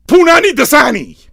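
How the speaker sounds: high, loud shouted punchline; yelling. Real, not acted. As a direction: belt it out to cap the rant. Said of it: "I love yelling".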